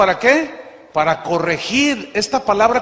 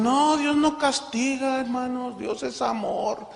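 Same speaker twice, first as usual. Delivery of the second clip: higher, slow, syrupy mock of a preachy believer; mocking sweet believer; 7 semitones higher, slower than usual. Preacher mocking Christians who deny that God punishes, in a higher, slow, syrupy sing-song. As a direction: parody them as a sugary sing-song. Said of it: "Hay cristianos que dicen".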